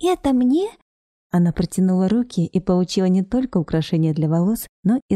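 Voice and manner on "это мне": put-on high, thrilled little girl; squealing with delight. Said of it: "завизжала от восторга".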